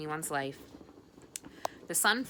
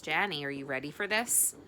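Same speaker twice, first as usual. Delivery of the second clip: ordinary delivery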